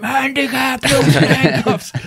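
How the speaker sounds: high, drawn-out retold scream; strained yell held back in volume; mock scream. Screaming at the guard while being handcuffed, mock scream. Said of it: "I was screaming at him".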